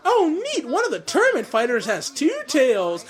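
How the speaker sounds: high-pitched cartoon character voice; Chip. Chip spotting a two-tailed tournament fighter, high put-on voice. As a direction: pitch it well up for a little excited remark. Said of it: "when Chip said".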